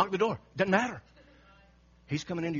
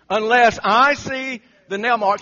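much louder and slow, laying down terms; insistent doubt; 8 semitones higher, 15 dB louder, slower than usual. Acted. Thomas refusing the disciples, in a loud slow insistence. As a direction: dig in like a stubborn skeptic.

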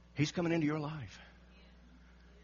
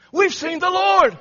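much higher, much louder, slow and jubilant; excited proclamation; 15 dB louder, slower than usual. Acted. Disciples telling Thomas, in a slow jubilant shout. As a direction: announce it like the best news ever. Disciples announce the risen Lord.

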